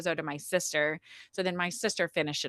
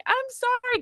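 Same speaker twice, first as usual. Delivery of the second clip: very high, squeaky sorry; sheepish apology. Retold or imitated. Mother apologizing to the midwife, squeaky and high. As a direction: apologize like a caught kid.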